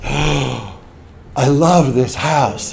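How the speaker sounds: drawn-out mock swoon over a house; hammed-up, slow adoration; gushing wife. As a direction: draw each word out, imitating a smitten wife.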